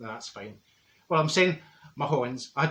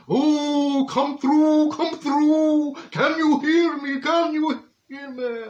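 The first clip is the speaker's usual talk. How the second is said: high, loud, slow spirit calling; hammed-up medium wail; 11 semitones higher, 5 dB louder, much slower than usual. Medium wailing for spirits to come through, high and slow. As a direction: overact a psychic summoning ghosts.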